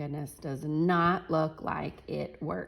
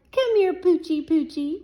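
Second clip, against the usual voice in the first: high, louder sing-song puppy call; coaxing call; 11 semitones higher, 7 dB louder than usual. Acted. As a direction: coax the dog over sweetly. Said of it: "whispered the other".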